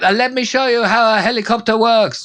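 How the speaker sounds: lightly voiced mercenary bragging; boastful driver. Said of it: "He's like".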